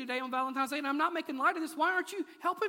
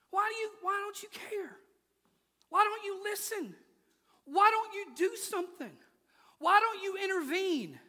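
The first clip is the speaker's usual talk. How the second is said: higher, pleading questions; lamenting prophet's plea; 7 semitones higher than usual. Retold or imitated. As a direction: beg God to act. Voicing Habakkuk pleading.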